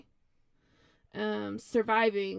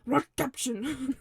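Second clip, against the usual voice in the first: Rot Caption barked with a harsh fake German accent; angry German accent; 2 semitones higher than usual. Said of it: "just say it really angrily".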